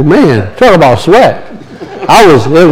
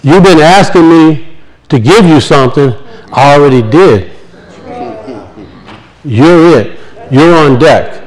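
inner voice of God; 7 semitones lower than usual. Retold or imitated. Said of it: "that inner voice says".

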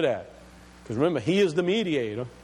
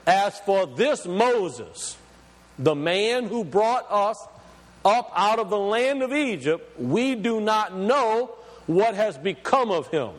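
very slow opening, then steady; deliberate Bible reading; slow, weighty scripture reading; 3 semitones higher than usual. Shown as a demonstration. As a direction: read it out as a quotation, slowly. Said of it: "Watch this".

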